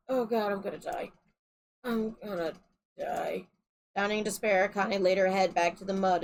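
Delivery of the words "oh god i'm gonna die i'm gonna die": despairing. Acted. Kane in despair at picture.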